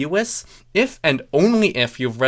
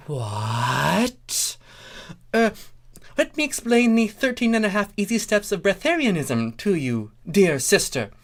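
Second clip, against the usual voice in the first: Starlight voice; 9 semitones higher than usual. Acted. Starlight speaks to Celestia.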